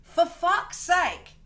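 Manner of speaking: drawn-out, weary curse; slow exasperated. Shown as a demonstration. To viewers, slow drawn-out exasperation. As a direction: stretch it out, fed up. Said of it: "Is this the first time I say "for fuck's sake"?".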